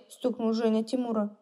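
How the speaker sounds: usual speaking voice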